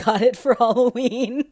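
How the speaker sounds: words breaking up into high, loud laughter; laughing. Real, not acted. Podcaster recalling her Halloween microphone, laughing. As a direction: laugh through the phrase.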